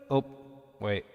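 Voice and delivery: normal talking voice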